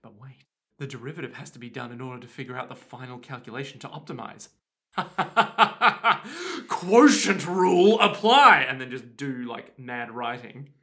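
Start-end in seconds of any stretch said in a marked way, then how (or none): 0.0-4.5 low, soft muttering through a sum; quiet working-out. Pretend. Student in a uni exam, muttering low to himself. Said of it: "I'd just be like". 6.7-8.7 slow, loud anime finishing-move call; yelled attack name. Pretend. Student announcing his move in an exam, drawn-out yell. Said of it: "I would just yell out".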